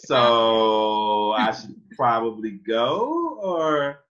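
drawn-out, high, loud uneasy question; awkward hesitation. Acted. Boy wonders if he should leave, hammed-up awkwardness. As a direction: stretch it slowly, rising into an unsure "or".

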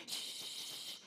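long hissed "shh"; drawn-out mimicked hushing sound; imitated TikTok shush. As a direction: shush like the little TikTok girl. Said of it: "la chiquita de TikTok que te dice".

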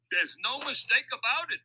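high, loud crook calling back. A narrator's reading. Louis answers Mac from the driver's cab, calling back. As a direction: throw it back loudly over your shoulder. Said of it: "Louis called back over his shoulder".

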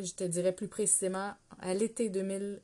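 ordinary talk

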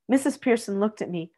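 plain reading voice

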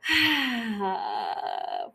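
long drawn-out sigh. Real, not acted. Fan thinking of an actor, long sigh. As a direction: let out a long swooning breath.

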